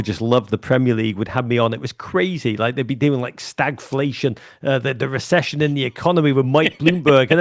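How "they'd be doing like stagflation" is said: ordinary talk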